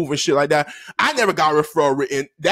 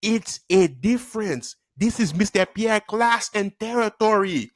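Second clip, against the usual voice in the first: slow, deliberate pronouncement; stern teacher; slower than usual. Retold or imitated. Teacher tells pupil whose class it is, stern. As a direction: stretch out each word to claim authority. Teacher asserting his authority.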